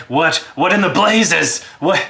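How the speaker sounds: high, loud bewildered dwarf; dwarf calls up from the bottom of the shaft; panicked dwarf shouting. Acted. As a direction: yell up at Frostbite in alarm. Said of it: "and he's just kind of like".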